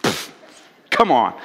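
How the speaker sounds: scoffing disbelief. Retold or imitated. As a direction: scoff at the huge number. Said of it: "Peter's going".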